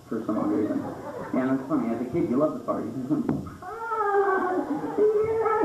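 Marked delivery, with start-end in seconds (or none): 3.6-5.6 put-on high, satisfied kid voice; kid relieved. Kid letting one go, high relieved voice. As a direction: sigh it out like a proud kid.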